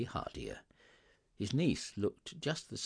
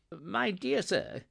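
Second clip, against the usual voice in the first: high, slow, drawn-out greeting; effusive Scrooge; 11 semitones higher, 3 dB louder, slower than usual. Acted. Scrooge taking the gentleman's hands, effusive. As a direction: greet him with effusive courtesy.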